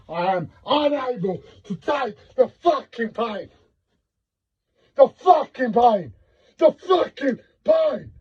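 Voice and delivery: anguished high-pitched outcry, building to a scream; raw, desperate wail that keeps climbing; real shouting. Real, not acted. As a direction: scream out unbearable pain. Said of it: "shouting out the way".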